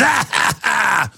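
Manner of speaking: short mock evil laugh; hammed-up villain laugh. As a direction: cackle like a cartoon villain. Villain laugh after world domination.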